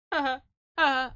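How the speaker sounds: big high cackling laugh; loud laughter. Real, not acted. Streamer cracks up at chat, loud laughing.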